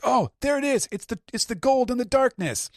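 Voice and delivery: high, loud thrilled cry; thrilled crew. Retold or imitated. Crew finding the chest, high thrilled voice. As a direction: gasp at the treasure. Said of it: "they're like".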